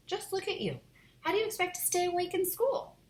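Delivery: high, scolding disbelief; exasperated. Acted. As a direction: rise up in scolding. Mom exasperated.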